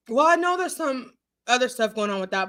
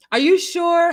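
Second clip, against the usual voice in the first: ordinary delivery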